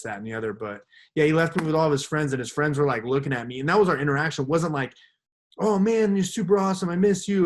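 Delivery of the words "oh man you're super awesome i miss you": higher, louder gushing fan act; mock gushing. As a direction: gush like a long-lost buddy, hammed up. Mocking a gushing friendly greeting.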